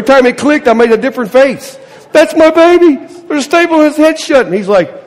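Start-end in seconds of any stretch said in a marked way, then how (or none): 2.1-4.5 high, loud distressed cry; anguished mother. Retold or imitated. Mother watching her son's head stapled, high distressed cry. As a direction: cry out for your child.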